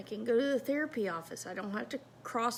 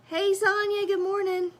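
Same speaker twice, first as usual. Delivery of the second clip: high, louder good-morning call; cheery greeting; 9 semitones higher, 6 dB louder than usual. Retold or imitated. Greeting coworker Sonya in the morning, cheery high call. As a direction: sing out a friendly hello.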